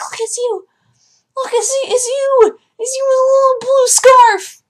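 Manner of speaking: very high, gleeful voice to the cat; excited pet talk. Retold or imitated. Showing her cat his fan portrait, very high excited voice. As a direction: gush at the cat delightedly.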